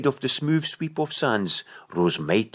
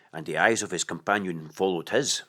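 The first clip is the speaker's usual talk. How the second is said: ordinary delivery, nothing marked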